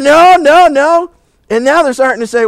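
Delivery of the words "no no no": loud, high-pitched denial; mock parental alarm. Parents told their kid is absent, alarmed denial. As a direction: act out rising worry in a raised voice. Parents voiced learning child is missing.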